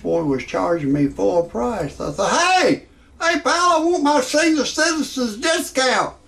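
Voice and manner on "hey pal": usual speaking voice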